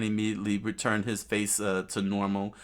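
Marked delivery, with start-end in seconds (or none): none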